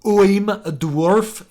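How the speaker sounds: slow, high, loud overdone dwarf. Shown as a demonstration. Showing the overdone way to write a dwarf, slow hammed-up voice. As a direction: drag it out like a stage dwarf. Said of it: "Instead of saying".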